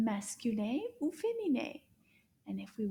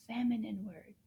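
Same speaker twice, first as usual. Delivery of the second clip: normal talking voice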